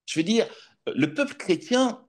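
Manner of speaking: soaring preacher's voice to the congregation; rising sermon. Acted. Preacher exhorting brothers and sisters on the anointing, fervent. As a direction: lift the words over the assembly, fervent.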